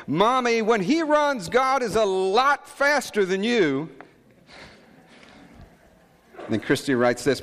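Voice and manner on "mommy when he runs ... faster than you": put-on high little girl voice, slow; high, slow little girl call; child voice. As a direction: imitate a small daughter calling out. Preacher voicing his small daughter.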